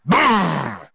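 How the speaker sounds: high revving car noise; car engine brrr. Acted. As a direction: rev it like an engine. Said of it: "said the car".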